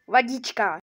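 normal talking voice

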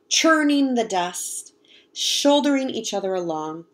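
slow, drawn-out storybook reading of churning; churning stretched out slowly, savoring the word; slow expressive reading. Shown as a demonstration. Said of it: "Churning the dust means".

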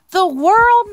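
high, loud awed mouse; drawn-out, high storybook awe; murmuring Little Mouse. Acted. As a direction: say it as a small mouse full of wonder. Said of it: "murmured Little Mouse".

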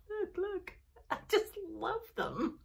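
ordinary delivery

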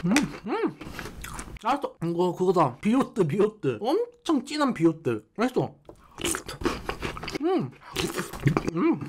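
talking while chewing cereal; muffled through food; mouth full, chewing. Real, not acted. Seen 2: Mouth closed and chewing throughout; spoonful of cereal taken at 6.0.

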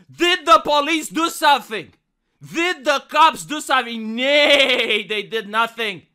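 loud mock indignation; hammy stage actor. Character rant.

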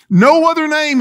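a sudden loud high cry; preaching climax. Acted. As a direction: ring it out from the pulpit.

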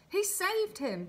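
high, loud call from another onlooker; excited, high-pitched shout from the crowd; eager shout. A narrator's reading. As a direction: call out a rival answer loudly. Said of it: "shouted another".

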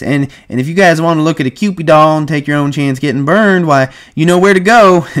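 high, loud madam, building; Susie. Retold or imitated. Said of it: "And Susie says".

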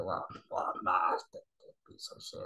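hushed, breathy whisper of an insult; mock whisper. Vlogger whispering a mock insult at the master, hushed whisper. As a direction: whisper it sneakily under your breath.

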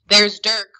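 loud opening announcement; narrated lookout's brisk alert; pronouncing. A narrator's reading. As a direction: announce the arrival with a burst of volume. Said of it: "one of them pronounced".

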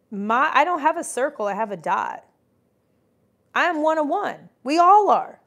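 [name] voice. Retold or imitated. Telling a podcaster she has no circle, [name] style. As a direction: channel [name], proud and grand. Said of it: "like in the [name] voice".

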